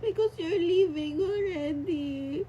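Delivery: reliving the goodbye in tears; tearful. Real, not acted. Retelling the sad exchange. (umiiyak habang ikinukuwento)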